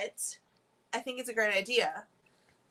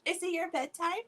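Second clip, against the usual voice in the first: higher gentle question; 8 semitones higher than usual. Real, not acted. Asks baby.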